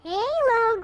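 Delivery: normal talking voice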